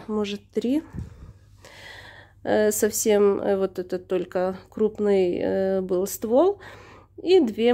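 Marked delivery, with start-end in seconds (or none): none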